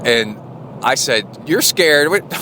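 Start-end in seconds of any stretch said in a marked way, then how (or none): 1.4-2.3 high-pitched mock disbelief; incredulous retort. Startled father answering his scared nine-year-old, incredulous retort. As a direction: throw it back higher, as a retold startled question.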